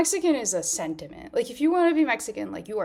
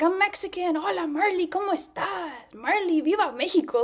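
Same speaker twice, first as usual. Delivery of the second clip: high, loud, cheery fake Mexican greeting; French stylist pretending to be Mexican, high and loud; mock eager faker; 10 semitones higher, 4 dB louder than usual. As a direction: oversell a claim to be Mexican. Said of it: "he's like".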